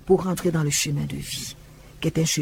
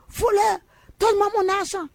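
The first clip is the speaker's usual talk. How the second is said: furious yell. Retold or imitated. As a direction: hurl it at Jesus inside the church. Woman storming into church, yelling.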